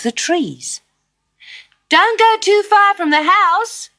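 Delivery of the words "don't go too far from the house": high, loud call from the window; mother calling out. A narrator's reading. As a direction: call after her like a worried mother. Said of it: "her mother called out".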